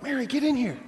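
high call across the house; hiss-free mock summons from the kitchen, pitched high. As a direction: sister calling Mary to come help, mock call. Martha calling from the kitchen.

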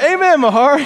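high, rising cheer; excited amen. Retold or imitated. Grateful man cheers on his mowing neighbor, high and excited.

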